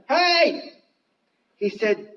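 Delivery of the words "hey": high-pitched "hey" called across the water; calling out. Acted. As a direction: hail them from afar. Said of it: "Jesus spoke unto them".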